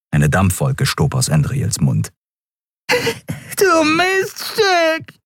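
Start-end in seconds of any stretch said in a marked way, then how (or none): 2.9-5.2 shrill, very high, drawn-out yelp; yowling curse. A narrator's reading. Broken-nosed addict yowls at the woman who hit him, shrill drawn-out yelp. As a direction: howl the insult through a broken nose. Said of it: "jaulte er".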